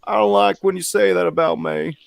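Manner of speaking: a hurt Clinton complaining to critics; Bill Clinton impression. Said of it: "be like".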